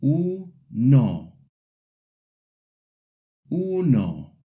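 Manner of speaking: Uno with pure U and O sounds; Uno, both vowels pure and unglided; pure Spanish vowels. Shown as a demonstration. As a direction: say Uno with clean short vowels. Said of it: "Repeat after me".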